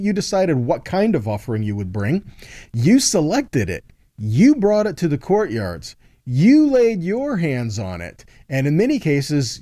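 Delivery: usual speaking voice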